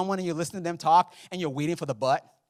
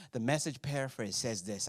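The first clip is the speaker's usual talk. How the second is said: ordinary talk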